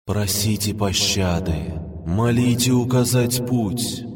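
slow eerie spirit whisper; barely audible whisper. A narrator's reading. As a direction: whisper it slowly, like fog itself. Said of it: "еле слышный шёпот сразу со всех сторон".

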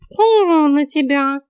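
high, loud, drawn-out cat disgust; snorting cat. A narrator's reading. Cat scolds the heroine's morbid joke, snorting. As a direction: spit it out high and long, offended. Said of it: "фыркнул кот".

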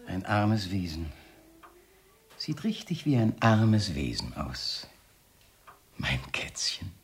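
slow, soft and menacingly tender; hushed, drawn-out purr of false pity; mocking pity. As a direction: sink down into a whisper. Mocking pity for his wife.

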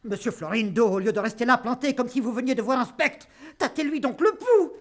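loud, frantic and high; panicked. Acted. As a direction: rush about shouting orders. Panicked father calls for remedy.

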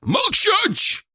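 furious shout. A narrator's reading. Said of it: "Шаповалов заорал так".

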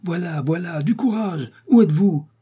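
high, carrying call from outside. Acted. The rescuers outside call up to Riri, shouting. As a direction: shout back to her from the street.

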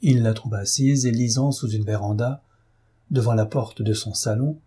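ordinary delivery, nothing marked

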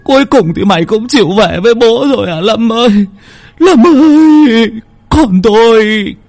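a broken father howling his grief to the sky; wailing cry. A narrator's reading. Father wails to his son's ghost, high and loud. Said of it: "mà gào lên".

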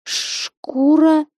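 high, tearful plea; voice full of tears. A narrator's reading. Insulted girl begging her boyfriend for protection, tearful. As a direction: pitch it high, as if about to cry. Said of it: "произнесла она полным слёз голосом".

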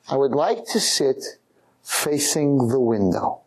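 spaced-out, weighty request; slow, deliberate. Acted. Diner choosing his seat, slow and deliberate. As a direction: draw out each word with intent. Solemn seating request to maitre d'.

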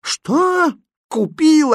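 very high, aghast exclamation; desperate cry. A narrator's reading. Mother hearing the money went on a puppy, desperate cry. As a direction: cry out in high-pitched disbelief. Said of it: "отчаянно крикнула Фатьма".

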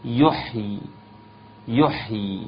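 يحيي stopped on a silent ي; pausal form demo. Shown as a demonstration. Teacher showing the students how to stop on يحيي. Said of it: "الوقوف على يحيي".

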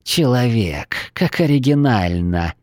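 put-on sneering lizard girl, hissed slowly; snide hiss. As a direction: hiss it out with mockery. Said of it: "ехидно прошипела ящерка".

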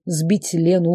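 usual speaking voice

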